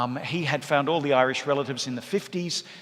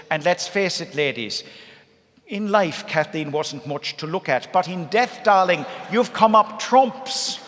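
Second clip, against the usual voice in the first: higher, louder, slow Irish aunt gushing; Mary's voice; 4 semitones higher, 5 dB louder than usual. Retold or imitated. Mary praising dead Kathleen in the coffin, loud, high and slow. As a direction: lay on the admiration like an old Irish matriarch.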